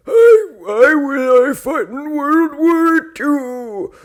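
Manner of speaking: very high, dragged-out veteran voice; hammed-up creaky old-timer, slow and high. Telling an old veterans joke, high slow old-man voice. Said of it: "one's like".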